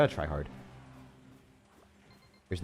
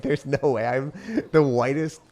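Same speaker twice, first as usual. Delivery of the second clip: higher-pitched mock disbelief; retold shock; 5 semitones higher, 4 dB louder, slower than usual. Streamer retelling the mix-up to chat, shocked. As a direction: act out being so shocked, drawing out the words. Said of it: "so shocked".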